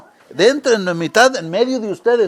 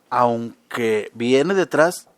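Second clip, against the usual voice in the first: slow emphasis; 7 semitones lower, much slower than usual. Acted. Preacher quoting John slowly.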